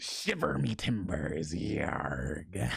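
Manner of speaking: slow, low pirate voice fading to a whisper; pirate growl. Shown as a demonstration. Showing a pirate voice, slow growl ending whispered. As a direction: growl it slowly and let yarg drop to a breath. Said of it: "That's kinda how a pirate goes".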